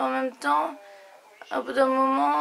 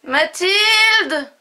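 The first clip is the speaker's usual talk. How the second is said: calling out loud; 9 dB louder, much slower than usual. Real, not acted. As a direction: yell the name to another room. Calling someone from another room.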